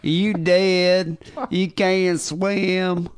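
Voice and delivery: slow mocking Southern drawl; Southern accent. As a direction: stretch out the Southern accent, taunting. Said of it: "that Southern accent".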